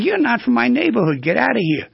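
high-pitched territorial bluebird; vigorous rebuff. Acted. Male bluebird chasing off an uptown cardinal, vigorous rebuff. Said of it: "physical rebuff by the male".

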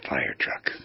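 "fire truck" whispered low on the breath; breathy whisper. Shown as a demonstration. Host shows listeners a heartfelt way to say it, whispered. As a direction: breathe "fire truck" out softly and intimately, as if from the soul. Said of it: "As if I wanted to communicate to you".

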